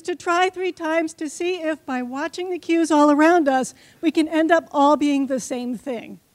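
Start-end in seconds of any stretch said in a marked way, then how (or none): none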